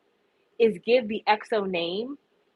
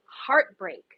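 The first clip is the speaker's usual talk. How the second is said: ordinary delivery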